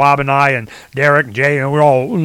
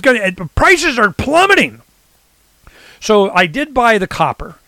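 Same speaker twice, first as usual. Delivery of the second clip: ordinary talk, nothing marked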